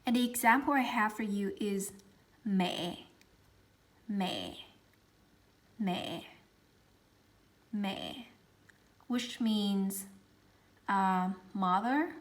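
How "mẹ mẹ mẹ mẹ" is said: usual speaking voice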